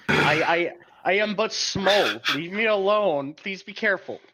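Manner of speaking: high, loud little plea; timid pleading. Acted. Acting small creature lifted off feet.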